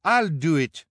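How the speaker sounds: loud, high insistence; competing volunteer. A narrator's reading. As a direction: claim the job over a rival. Rivals clamour to volunteer.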